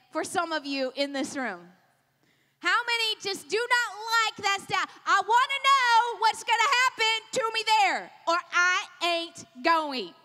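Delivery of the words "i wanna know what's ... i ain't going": loud, emphatic put-on protest; mock defiant refusal. Playing a listener balking at the unknown, mock defiant. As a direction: dig in and protest, slowing right down at the end.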